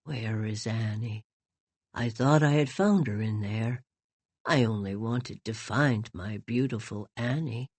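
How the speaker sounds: put-on low, soft, lost old voice; old man softly asking for his lost bride; heartbroken old man. A narrator's reading. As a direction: speak like a grieving old man. Heartbroken old man searching for Annie.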